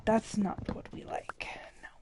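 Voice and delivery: whispered self-correction. Real, not acted. Crafter whispering to herself as she fixes a mistake. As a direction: hush it out while fixing a mistake. Muttering over her craft.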